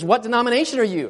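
high, loud, fast question; eager call. Retold or imitated. Woman at the pump calling out after him. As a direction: call it out quickly to catch him.

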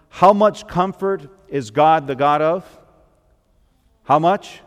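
preaching call. Real, not acted. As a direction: pull the congregation in with a rising question. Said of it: "preacher calls out to crowd".

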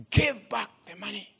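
a hammed-up order from the leader; retold shout. Rebel leader ordering the gunman, retold shout. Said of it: "the leader just shouted".

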